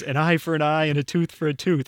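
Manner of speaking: half-strength shouted stage line; retold shout. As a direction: reenact an overeager line shouted in rehearsal. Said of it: "and I, like, shouted it".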